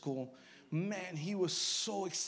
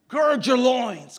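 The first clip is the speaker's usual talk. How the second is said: slow, booming shout, pitched up; thundering command; 7 semitones higher, 16 dB louder, much slower than usual. Retold or imitated. The Lord telling Job to brace himself, thundering command. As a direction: boom it out slowly as a divine order.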